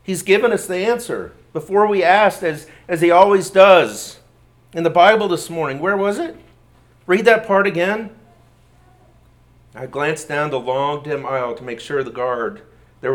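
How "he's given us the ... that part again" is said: higher and louder, eager rush; excited. A narrator's reading. Betsy urging Corrie to reread, excited and louder. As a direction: bubble over with discovery. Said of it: "she said excitedly".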